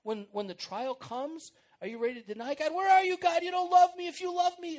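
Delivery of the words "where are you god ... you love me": higher, much louder shouting at God; loud, desperate complaint to God; angry accusing prayer. Retold or imitated. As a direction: hurl the accusation at God in a mock rage. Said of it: "that's the prayer that says".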